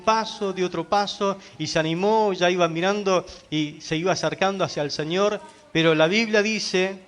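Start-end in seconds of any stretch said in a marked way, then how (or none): none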